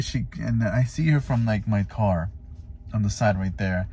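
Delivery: ordinary delivery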